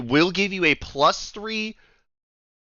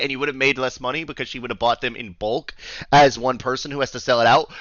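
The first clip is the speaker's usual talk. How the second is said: ordinary talk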